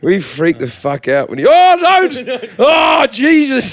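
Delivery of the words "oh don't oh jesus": high, loud panicked shout; hammed-up terrified shriek from the back seat; mock panic. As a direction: scream like you're terrified. Acting the kid freaking out.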